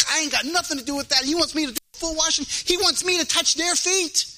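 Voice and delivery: fast, grumbling mock complaint. Preacher mocking a squeamish churchgoer, fast grumble. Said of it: "I'll be like".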